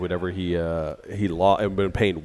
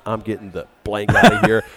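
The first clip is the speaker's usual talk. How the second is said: much louder exasperated exit line; fed-up outburst; 2 semitones higher, 8 dB louder than usual. Retold or imitated. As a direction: storm off shouting it. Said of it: "And he goes".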